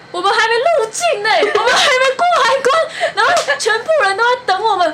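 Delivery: high, loud, rushed telling, laughing through it; bursting out laughing at her own blunder; panicked laughing realization. Retold or imitated. Student retelling to friend, loud panicked laughter. Realizing they missed customs.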